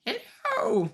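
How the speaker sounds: high sing-song hello. Real, not acted. Owner greeting the dog in a high sing-song voice.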